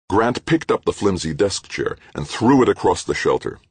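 ordinary delivery, nothing marked

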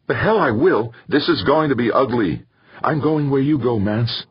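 high-pitched, heated defiance; defiant refusal. A narrator's reading. Woman refusing to stay back from the mob, defiant.

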